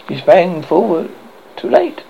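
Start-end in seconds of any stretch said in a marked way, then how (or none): none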